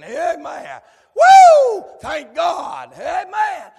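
rising preacher's cry, building to a shout; preaching at full force. Acted. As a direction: bring the congregation up with you.

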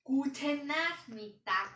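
slow, loud hello; loud slow greeting. Shown as a demonstration. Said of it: "Ist das gut so?".

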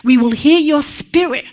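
fervent prayer. Acted. Preacher praying aloud over the congregation, fervent and loud. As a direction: pray out over the people with full fervour.

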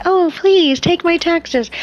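high, slow sarcastic plea; sarcastic high begging; mock eager taxpayer. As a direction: gush it like a naive fan. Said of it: "who's gonna purposely say".

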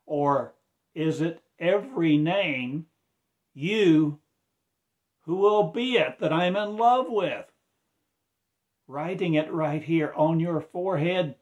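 loud, high, impassioned sermon cry; fervent preaching. Acted. Preacher proclaiming to his flock, loud and fervent. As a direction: preach it out at full force.

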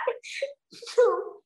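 real high-pitched laughter; high giggle. Real, not acted. Amused by her own joke.

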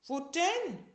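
put-on high, loud small-child voice; little tiger asking. A narrator's reading. Sick little tiger asking the wolf, high small voice. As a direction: ask like a tired little kid. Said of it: "fragte der kleine Tiger".

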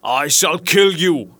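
lightly voiced vengeful monster, drawn out; menacing genius. A narrator's reading. Genius telling the merchant he will die, slow menace.

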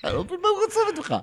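very high, fast ranting wife voice. Retold or imitated. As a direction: rattle it off in her high outraged voice. (אישה רוטנת בקול גבוה ומהיר)

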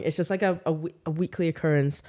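usual speaking voice

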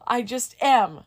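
high, loud, helpless retort; re-enacted outburst, easing off at the end; acted flustered panic. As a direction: imitate yourself crying out in exasperation. Acts out exasperated frightened reply.

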